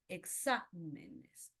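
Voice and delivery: "exámenes" slowly, e-XÁ... menes; stress on antepenultimate syllable. Shown as a demonstration. Teacher giving an esdrújula example, slow "exámenes" stressed on XÁ. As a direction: hold XÁ in "exámenes", then let menes fall away. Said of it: "el acento está en la antepenúltima".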